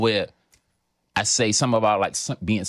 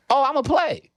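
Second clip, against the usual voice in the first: gleeful boast; 12 semitones higher, 3 dB louder than usual. Real, not acted. Boasting of playing with the premise.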